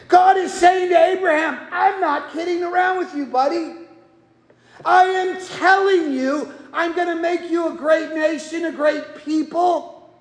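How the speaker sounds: fervent pulpit shout; preaching at full force. Acted. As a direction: proclaim God's promise to the back row. Preaching God's promise.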